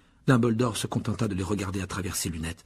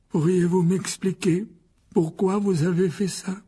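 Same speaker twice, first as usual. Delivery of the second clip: put-on slow, higher, sorrowful old man; disappointed headmaster; 7 semitones higher, 4 dB louder, slower than usual. A narrator's reading. The headmaster asks the boys why, disappointed. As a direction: let the letdown weigh on each word. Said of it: "la nuance de déception qu'il y avait dans sa voix".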